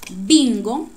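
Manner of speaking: teacher calling out BIN in Bingo; stress on BIN. Shown as a demonstration. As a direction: hit BIN in Bingo hard for the class. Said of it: "miren que hacemos el acento en bin".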